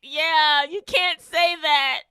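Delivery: laughing. Real, not acted.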